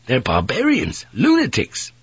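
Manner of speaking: appalled cry leaping high; horrified outburst. Acted. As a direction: burst out in high-pitched horror. Sailor horrified by beheading account.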